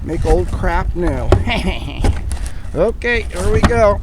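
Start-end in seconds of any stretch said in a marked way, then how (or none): 1.3-2.7 short self-amused chuckle. Real, not acted. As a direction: chuckle at your own joke. Chuckles at own remark.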